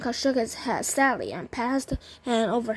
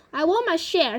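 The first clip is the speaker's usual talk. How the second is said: mildly shouted, higher-pitched demand; 6 semitones higher, 3 dB louder than usual. A narrator's reading. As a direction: pipe up with an insistent call. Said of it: "shouted Grandpa George".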